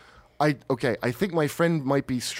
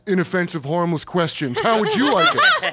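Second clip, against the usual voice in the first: goading, louder and higher; picking a fight; 5 semitones higher, 4 dB louder than usual. Retold or imitated.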